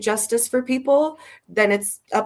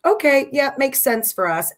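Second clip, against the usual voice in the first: mock agreeable voice; 8 semitones higher, 4 dB louder than usual. Mock quote of complacent voters.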